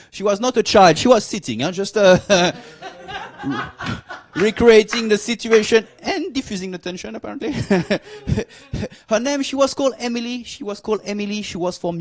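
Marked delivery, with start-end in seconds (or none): none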